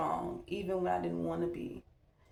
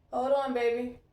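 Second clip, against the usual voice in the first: calling out; 4 semitones higher, 4 dB louder than usual. Real, not acted. Calls to child at the door.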